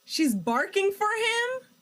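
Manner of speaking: high, loud, drawn-out disbelief; incredulous surprise. Real, not acted. Player reacting to the woman barking on cam, incredulous. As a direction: ask it loudly, pitch soaring in disbelief.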